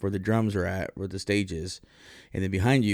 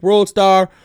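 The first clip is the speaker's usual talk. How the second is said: high, loud shout; excited call-out; 11 semitones higher, 12 dB louder than usual. Retold or imitated. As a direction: yell it out to hype the fight. Calling out at a fight.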